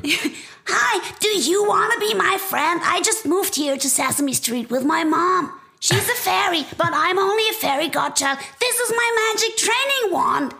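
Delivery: very high little fairy voice; cute squeaky fairy child; Abby Cadabby voice. Retold or imitated. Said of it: "hat so eine ganz rauchige Stimme".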